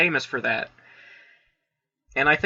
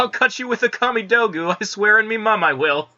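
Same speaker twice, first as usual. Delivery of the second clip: higher, hammed-up Cockney accent; mock Cockney; 5 semitones higher, 3 dB louder than usual. Host threatens with a Kamidogu as Scarlet, mock Cockney. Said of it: "No more voices for stealth action Scarlet".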